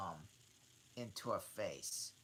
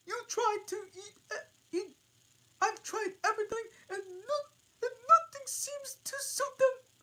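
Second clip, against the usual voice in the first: high squeaky bird voice, sobbing; sobbing pigeon; 12 dB louder than usual. Acted. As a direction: wail it like a tearful pigeon. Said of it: "more subdued tone, a kind of sob".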